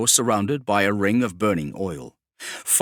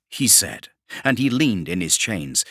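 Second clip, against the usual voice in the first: usual speaking voice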